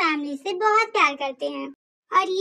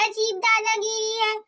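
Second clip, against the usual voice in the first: ordinary delivery, nothing marked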